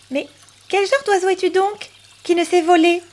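high, teasing bird voice; high, questioning little bird; cheeky little bird. Acted. Bird mocks the duck.

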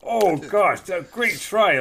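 high, loud mock cheer; over-the-top jokey commiseration; mock encouragement. Acted sporting cheer, joking.

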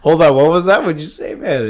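high, loud incredulous whoa; laughing disbelief at the moon-cheese claim; mock disbelief. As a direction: act stunned by what you heard. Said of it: "I'm like".

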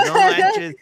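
high, loud, shocked exclamation; loud high mock shock; shocked no manches. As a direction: cry out in shock. Retold self-reaction to accent.

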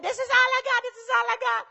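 high, pleading cling to what he has; clutching boy. Retold or imitated. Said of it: "ran for his life with his five and said".

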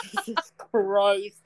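slow, drawn-out self-reproach; retold dismay, dragging each word out; mock mortified. Retelling own mortified reaction to slip.

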